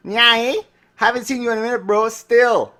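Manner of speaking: high-pitched, hammed-up Canadian accent. Shown as a demonstration. Podcaster showing off a Canadian accent to listeners, hammed-up and high. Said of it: "That's my Canadian accent".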